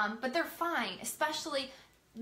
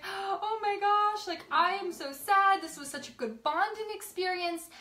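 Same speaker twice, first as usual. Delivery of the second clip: high-pitched, hammed-up weepy wail; retold sobbing, pitched way up; mock bawling; 8 semitones higher than usual. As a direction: imitate yourself hysterically sad. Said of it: "I was hysterical. I was, like, bawling".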